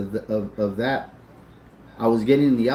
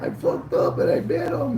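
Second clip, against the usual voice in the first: high, tearful squeal; mock crying; 13 semitones higher than usual. Brother admits the bet to his sister, crying. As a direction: blubber out a confession in a high voice. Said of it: "What are you crying for".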